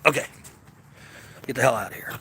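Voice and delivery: playful whisper, no voice; whispered shoo. Real, not acted. Shooing the dog away from the camera, whispered playfully. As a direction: hiss it gently at the pup.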